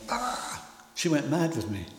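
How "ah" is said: short high shriek, mock wail; a little girl's squeal of protest, imitated; imitated child's scream. Father imitating his daughter shrieking over her dolly. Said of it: "screamed the place down".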